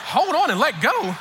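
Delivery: high, drawn-out incredulous question; bewildered cry. Retold or imitated. Rider repeats attendant's advice as ride launches, bewildered cry. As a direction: slowly wail the baffling instruction back as a question.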